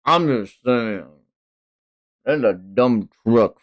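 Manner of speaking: ordinary delivery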